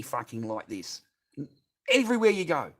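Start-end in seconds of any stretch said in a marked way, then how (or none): 1.9-2.7 a sudden loud, high burst; outburst. Real, not acted. Venting to the boys about phones, bursting out loud. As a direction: blurt it out loud.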